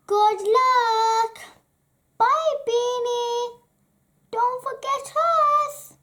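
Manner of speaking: high, loud cheerful farewell; excited farm animals calling goodbye; farewell calls. Acted. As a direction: call after them as they leave.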